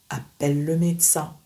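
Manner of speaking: normal talking voice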